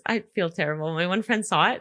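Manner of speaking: ordinary talk, nothing marked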